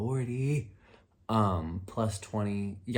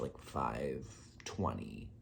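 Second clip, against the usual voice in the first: ordinary delivery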